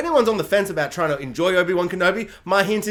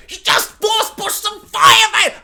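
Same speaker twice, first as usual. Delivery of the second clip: much higher, louder, slow incredulous yell with a laugh; half-laughing outburst of disbelief; shouting in amused frustration; exasperated shout; 12 semitones higher, 6 dB louder, slower than usual. Real, not acted. Exasperated rant at Vader scene.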